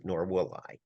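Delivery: normal talking voice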